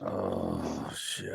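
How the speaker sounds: barely voiced, low and hushed dismay; hushed oh shit. Sick man realizing his illness, hushed and dismayed. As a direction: breathe it out under your breath.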